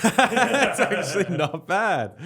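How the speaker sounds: slow, laughing praise. Real, not acted. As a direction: chuckle it out, amused. Reacting to the accent attempt.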